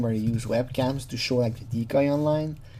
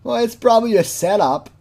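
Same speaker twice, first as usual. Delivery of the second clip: loud, high-pitched mocking quote; hammed-up imitation of his protest; mock excuse; 7 semitones higher, 6 dB louder than usual. Imitating what he says when caught, loud high mock voice. Mocking men who go anyway.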